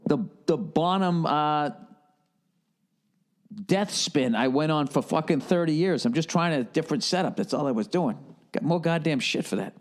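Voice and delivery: usual speaking voice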